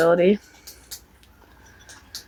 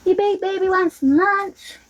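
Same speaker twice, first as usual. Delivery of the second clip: put-on baby talk; 8 semitones higher than usual. Cooing to the dog.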